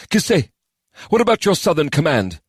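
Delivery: a hint of a high stern lord; lightly voiced commander. A narrator's reading. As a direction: question the general firmly. Sajay voiced in story.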